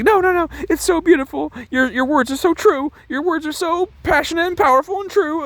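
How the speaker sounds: very high, squeaky imitation of a moved woman; hammed-up, overcome with emotion; mock tearful gushing. Tearful girl gushing over his song, mock weepy. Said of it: "she's just so moved".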